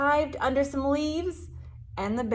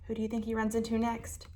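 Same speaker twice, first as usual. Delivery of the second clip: ordinary delivery, nothing marked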